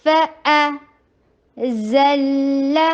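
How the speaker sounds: فَأَزَلَّ very slow with the doubled lام held; tajweed model reading. Shown as a demonstration. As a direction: stretch فَأَزَلَّ with a long held لّ. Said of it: "لام کے اوپر بینیا کا time سب سے میکس دیجیے". (فَأَزَلَّ میں لام پر زیادہ ٹھہراؤ)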